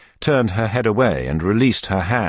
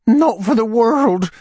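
high, fluttery widow voice; fussy widow voice; 5 dB louder, slower than usual. A narrator's reading. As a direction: play the flustered widow, pitched high. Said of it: "Widow Corney's voice".

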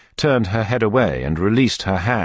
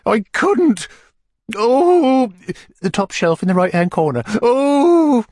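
high, breathless fussy widow; swooning widow; 5 dB louder, slower than usual. A narrator's reading. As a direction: gasp it out like a fainting widow. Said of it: "underwent a convulsion".

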